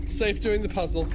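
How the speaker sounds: ordinary delivery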